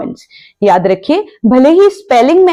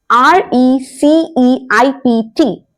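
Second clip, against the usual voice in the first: letter by letter, spaced and slow; slowly spelt letters; slow spelling; much slower than usual. Shown as a demonstration. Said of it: "spelling the word".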